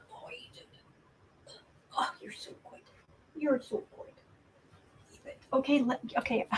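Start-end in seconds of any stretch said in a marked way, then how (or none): 0.1-5.2 soft whisper to the cat; whispered praise. Real, not acted.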